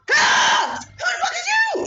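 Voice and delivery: high shrieking scream at an intruder; mother retelling her scream at a hooded intruder; terrified scream. Retold or imitated. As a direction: scream it out, pitch flying high and loud.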